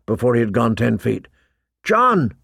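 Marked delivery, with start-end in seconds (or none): 1.8-2.3 narrated shout. A narrator's reading. Pip calling after fleeing John, contained shout. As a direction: call after someone, pitched up. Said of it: "Pip shouted after him".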